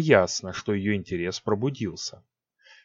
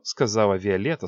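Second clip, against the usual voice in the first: plain reading voice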